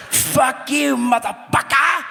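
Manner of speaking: high, drawn-out Scottish yell; hammed-up Scottish battle shout; bad Scottish accent. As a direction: holler it in fake Scottish. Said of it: "the Scottish people speak?".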